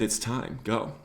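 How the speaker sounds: barely above a whisper, calm. Retold or imitated. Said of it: "he whispered to me".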